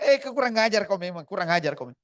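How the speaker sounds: loud scolding mother's voice; angry mother. Retold or imitated. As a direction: scold the son for pawning the house. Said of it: "Marah dia".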